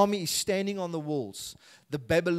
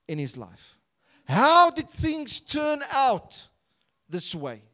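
preaching build; 10 semitones higher, 8 dB louder, slower than usual. Acted.

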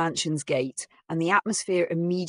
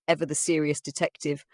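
plain reading voice

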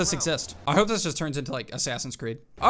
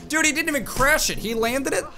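high-pitched, incredulous protest; real indignant surprise; upset disbelief; 9 semitones higher than usual. Real, not acted. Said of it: "I don't know why I'm upset about that".

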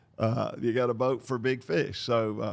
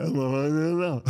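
mock mumbling; 3 semitones higher than usual. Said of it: "made his voice sound as if he were mumbling".

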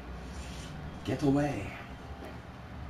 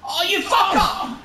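high, loud cursing outburst; furious high curse at a rival player; angry yell; 4 dB louder than usual. Real, not acted. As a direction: let rip at the opponent. Outburst at opponent in game.

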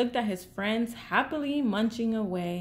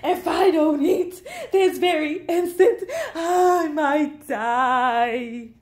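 melodramatic calling out; 6 semitones higher, 6 dB louder than usual. A narrator's reading. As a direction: wail like a starving bird at death's door. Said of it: "Bird called to his friends".

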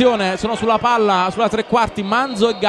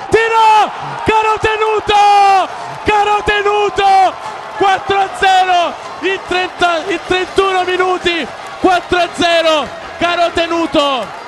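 goal call; 4 semitones higher than usual. Real, not acted. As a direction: drag out the scorer's name. (urlo del gol a pieni polmoni)

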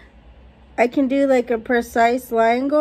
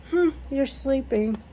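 normal talking voice

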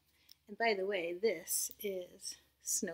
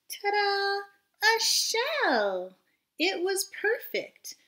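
much higher, louder ta-da; excited reveal; 12 semitones higher, 9 dB louder, much slower than usual. Acted. Teacher revealing Norman's new shell, excited. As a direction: present it with a flourish.

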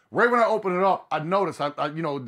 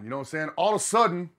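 ordinary delivery

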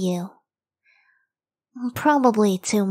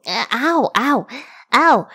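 pained ow; 3 semitones higher, 4 dB louder, slower than usual. Real, not acted. Being hit on the back.

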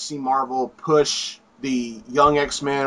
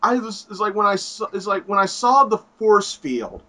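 ordinary delivery

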